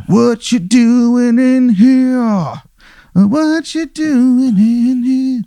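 very high, drawn-out cartoon voice; high-pitched, wailing nosy intruder; intruding doctor voice. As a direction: stretch every word slowly, way up high. Game master voices another doctor intruding.

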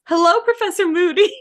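lightly imitated girl calling out a pointed hello; Hermione. Hermione greeting a professor to scare off Malfoy, pointedly loud. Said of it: "And she goes".

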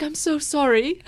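put-on British accent. Imitating Mary Berry apologizing to a contestant, light British accent. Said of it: "impersonating Mary Berry".

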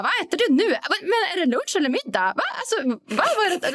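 high, mock baffled questioning; baffled coworkers. Coworkers baffled by her three o'clock lunch box, mock bewildered. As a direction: act out confused colleagues. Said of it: "de bara".

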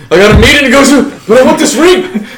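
high, loud, rushed plea; frantic hurried quote. Retold or imitated. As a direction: blurt it out in a frantic rush. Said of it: "he's like".

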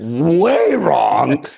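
"way" loud, long and swooping; milk "way" for the laugh; exaggerated stress. Comic repeat of how wrong.

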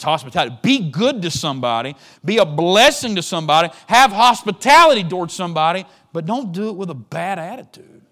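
loud, high fervent exhortation; preaching at full force. Acted. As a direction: shout it from the pulpit.

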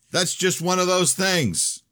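high, drawn-out exasperation; heated retort. A narrator's reading. Danielle pressing him in a quarrel over money, exasperated. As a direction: argue it high and slow.

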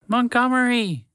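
high squeaky mouse shout; shouting a name. Acted. Mouse shouting for his buried friend, high and urgent. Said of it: "the mouse shouted instinctively".

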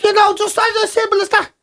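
squeaky patronising know-it-all; mimicking a glib adviser, high pitched; high mock adviser. Mocking dismissive advice-giver.

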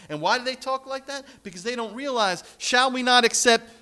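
high, loud preaching climax; ringing pulpit shout. Acted. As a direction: lift it up and proclaim. Preaching climax at full force.

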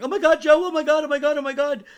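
very high, piercingly loud shrieks; imitated background screaming. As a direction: scream it nonstop, imitating a panicked sonographer. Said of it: "I can hear the sonographer going".

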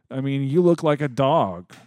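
usual speaking voice

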